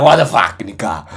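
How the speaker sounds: loud disbelieving outburst; shocked shout. Retold or imitated. Trader reacting to his friend's big win, shouting. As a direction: yell it in shock at the profit. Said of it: "I'm like".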